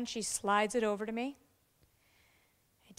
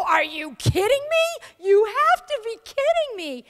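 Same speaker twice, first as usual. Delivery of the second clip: high, louder disbelief at her friend; incredulous cry; 10 semitones higher, 9 dB louder than usual. Retold or imitated. Speaker echoing the boss to the new officer, high and incredulous. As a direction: throw her words back, high and incredulous. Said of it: "my turn to go".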